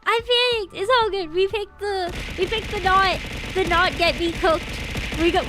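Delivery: ordinary delivery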